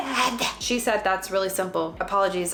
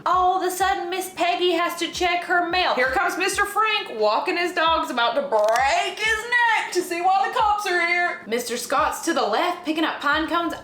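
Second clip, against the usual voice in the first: gossipy mock narration; 9 semitones higher than usual. Nosy vlogger mocking neighbors who rush out, gossipy. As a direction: spill the neighborhood tea with glee.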